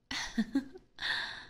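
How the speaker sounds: a rising, airy laugh of delight; airy teasing titter; breathy mocking giggle. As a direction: giggle at their naivety. Vampire mocks the runaway.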